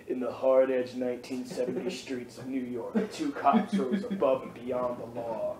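low, soft movie trailer narrator; trailer voiceover. Pretend. Friends joking about their trailer, deep trailer narrator. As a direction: read it like a gritty seventies trailer.

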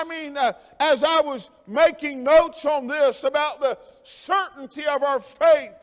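usual speaking voice

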